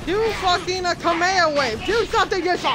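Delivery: frustrated shout at the teammate; angry yelling. Real, not acted. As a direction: yell at him, high and frustrated.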